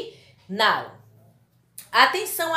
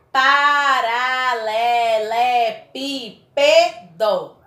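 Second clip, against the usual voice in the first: loud, very slow "paralelepípedo", each syllable held; 6 dB louder, much slower than usual. Shown as a demonstration. Teacher shows a seven-syllable example, each syllable stretched. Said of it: "Tem sete sílabas".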